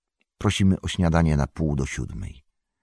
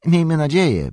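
Miejmy nadzieję said higher and louder, Miejmy stressed; fervent echo; 13 semitones higher, 7 dB louder than usual. Acted. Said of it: "powtórzył Butterbur".

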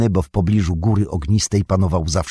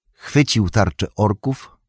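usual speaking voice

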